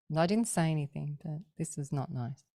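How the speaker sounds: ordinary delivery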